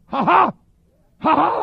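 loud, high barked ha-ha; imitated pulpit ha, shouted in bursts; preacher's "ha" imitated. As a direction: mimic a preacher's punched "ha" outbursts. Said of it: "Have you ever heard him go".

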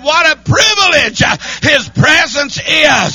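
shouted high, slow preaching; preaching at full force; preacher voice. Shown as a demonstration. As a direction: belt it out like a preacher in full flight. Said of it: "I wish I was a preacher tonight".

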